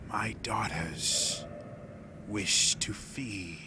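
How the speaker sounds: very slow whispered threat; hushed, hungry monster whisper; menacing spider creature. Acted. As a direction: breathe it out word by word.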